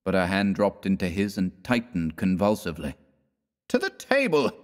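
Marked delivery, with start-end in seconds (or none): 3.7-4.5 a higher-voiced Fallowby calling everyone, only a mild mock shout; hungry shout. A narrator's reading. Fallowby summons the hungry party to dinner with a mock shout. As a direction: call the guests to dinner, hammed-up. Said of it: "shouted Fallowby".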